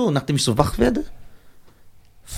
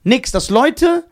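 much higher, amazed insistence; excited; 10 semitones higher than usual. Real, not acted. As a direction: marvel that nothing happened. Stressing he was unhurt.

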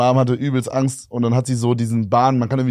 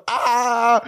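mock scream; 10 semitones higher than usual. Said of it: "alle haben so geschrien, so richtig laut".

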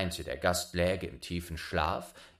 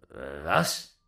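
startled father's what; a hint of shocked father; surprised cry; 6 semitones lower, 3 dB louder than usual. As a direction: blurt it in surprise. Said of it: "rief der Vater".

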